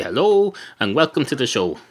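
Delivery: usual speaking voice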